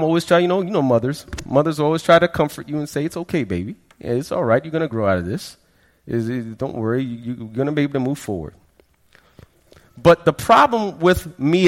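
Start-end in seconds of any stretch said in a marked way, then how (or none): 3.0-8.5 soft, soothing mother's voice; mom comforting. Retold or imitated. Mother reassuring her troubled son, softly soothing. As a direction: hush him gently like a worried mom.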